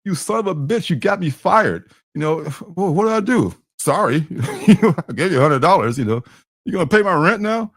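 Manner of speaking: high, outraged and whiny; upset coworker. Retold or imitated. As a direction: yell at him in high disbelief. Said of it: "saying, like".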